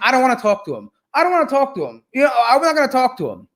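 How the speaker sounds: mock him like a sulking kid; pouty mimicry of a scared debater; mocking sulky voice.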